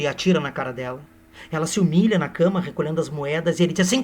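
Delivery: ordinary talk, nothing marked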